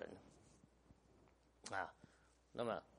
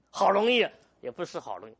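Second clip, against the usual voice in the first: emphatic loud; 5 semitones higher, 11 dB louder than usual. Real, not acted. Pastor insisting it is impossible.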